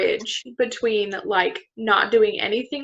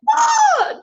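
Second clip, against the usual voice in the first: sudden high yell; retold panicked yell; 5 dB louder than usual. Surrounded by zombies and blind, a high panicked yell. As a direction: yelp in mock panic at zombies. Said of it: "I'm just like".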